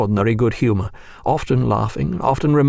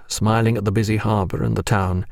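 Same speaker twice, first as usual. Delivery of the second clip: ordinary delivery, nothing marked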